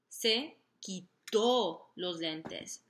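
se quitó los lentes, quitó slow with the final O stressed; teacher drawing out quitó, the O stressed. Shown as a demonstration. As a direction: spell it out slowly, leaning on the O of quitó. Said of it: "more emphasis on pronouncing that O".

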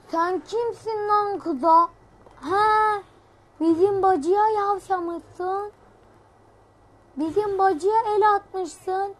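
slow drawn-out mock thug threat to the bear; street tough. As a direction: square up like a neighbourhood hard man defending his sister. Plays a street tough confronting the bear.

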